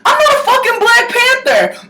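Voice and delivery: a sharp high shout stressing not; indignant shout. Real, not acted. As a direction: yell it back at the commenter.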